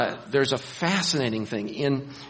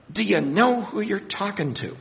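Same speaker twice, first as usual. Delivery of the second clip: exasperated rebuke; 8 semitones higher than usual. Retold or imitated. Preacher rebuking the rich man.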